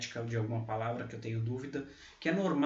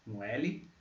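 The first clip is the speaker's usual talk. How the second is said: ordinary delivery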